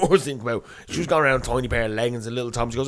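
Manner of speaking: normal talking voice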